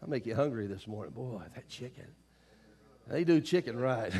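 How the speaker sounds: usual speaking voice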